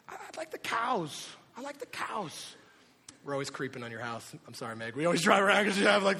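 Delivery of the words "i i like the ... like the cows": put-on high, small little-kid voice; imitating his toddler. Two-year-old naming his favorite animal, high imitated child voice. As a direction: answer like a shy small child.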